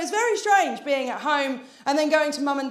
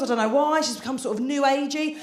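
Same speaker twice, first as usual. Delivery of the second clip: usual speaking voice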